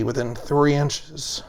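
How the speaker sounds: usual speaking voice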